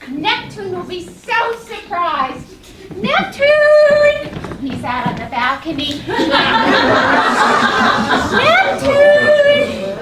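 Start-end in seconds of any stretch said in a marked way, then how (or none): 3.0-4.6 loud, high call across the house; loud sing-song call to husband. Acted. Calling husband from balcony. 6.1-8.6 airy rising laugh; breathless excited giggle; laughing between calls. Acted. Laughing while calling husband. 8.7-9.9 loud, high laughing call. Acted. Calling husband again, laughing.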